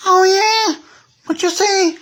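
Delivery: very high, squeaky "Oh, yeah?" dare; squeaky taunting toy. Pretend. One toy squeaking a dare at the train. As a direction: squeak it out like a cheeky little toy picking a fight. Toys quarrel in pretend play.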